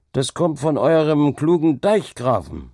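loud, high-pitched jibe across the table; calling out. A narrator's reading. Villager complaining about the dike-master at the tavern, calling out. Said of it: "rief einer von den Geestleuten".

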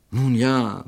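ordinary delivery, nothing marked

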